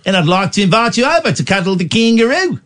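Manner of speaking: hammed-up Aussie host with an invitation; Australian accent. Shown as a demonstration.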